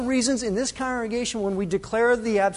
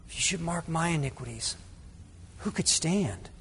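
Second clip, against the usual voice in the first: hushed rereading; 4 semitones lower, 13 dB quieter than usual. Acted. Verse reread slowly, hushed.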